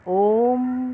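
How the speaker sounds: ओम with the vowel stretched long and slow; drawn-out om. Shown as a demonstration. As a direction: hold the ओ of ओम. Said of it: "ओम अर्थात ये प्लुत स्वर हुआ". (ओम को लंबा खींचकर)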